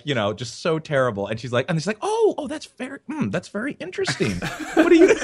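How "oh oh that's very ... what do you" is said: put-on high, sing-song talking down to a child; condescending. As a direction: humor a toddler's bad drawing, pitched up. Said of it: "I was being talked to like I was three".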